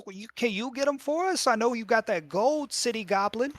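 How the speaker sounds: put-on high-pitched pleading child voice; wheedling goblin kid. Goblin kid asking Inchi to buy firecrackers, wheedling.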